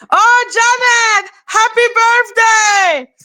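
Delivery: high-pitched, delighted cheer; excited birthday greeting. Real, not acted. Streamer greeting a viewer on their birthday, excited.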